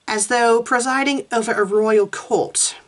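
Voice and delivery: usual speaking voice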